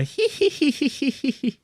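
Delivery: high cackling hee hee hee; evil witch cackle. Shown as a demonstration. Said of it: "Like an evil witch".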